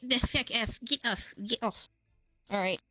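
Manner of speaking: usual speaking voice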